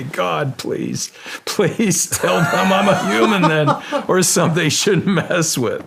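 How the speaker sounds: high, loud pleading prayer broken by laughter; laughing mock prayer. Swimmer retells begging God while laughing at himself. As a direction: beg God through a laugh.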